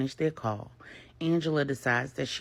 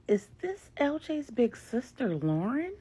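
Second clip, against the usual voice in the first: surprised; 11 semitones higher than usual. Acted. Surprised recognition of old friend.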